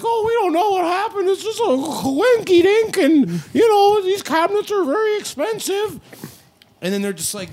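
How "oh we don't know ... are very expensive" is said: very high, loud, squeaky cartoon voice; high-pitched mock corporate excuse; mock company spokesperson. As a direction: play Wayfair as a squeaky cartoon mouse, words stretched out. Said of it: "Like, oh, the Wayfarer's like ... Minnie Mouse works for Wayfair?".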